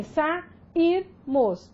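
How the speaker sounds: "sairmos" said slowly as sa-ir-mos; separated syllables. Shown as a demonstration. Teacher showing students the vowel separation, syllable by syllable. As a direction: break "sairmos" into three syllables, the I on its own. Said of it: "Você ouve a separação de uma vogal e da outra".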